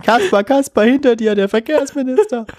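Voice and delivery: high squeaky warning to Kasper; puppet show kids shouting. Pretend. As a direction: call out like children at a puppet show. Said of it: "so einem Kasperle-Theater". (hohe Kinderrufe beim Kasperletheater)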